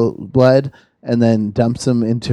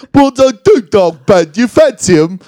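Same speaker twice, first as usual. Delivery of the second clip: higher, louder caricature of Sheffield speech; imitated Sheffield local, hammed up; mock Sheffield accent; 6 semitones higher, 5 dB louder than usual. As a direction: stretch it in a broad Sheffield accent. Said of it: "And he goes".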